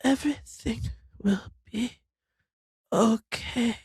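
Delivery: soft, fading, sleepy murmur; nodding off. Retold or imitated. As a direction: trail off as if falling asleep. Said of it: "it sounds like I'm, like, dying".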